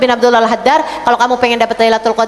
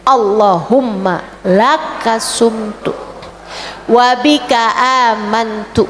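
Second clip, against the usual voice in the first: unhurried, word by word prayer recital; slow, careful breaking-fast prayer; slow recited prayer; 4 semitones lower than usual. Shown as a demonstration. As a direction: savour each word of the prayer, slowly. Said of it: "Jadi itu doa dibacanya".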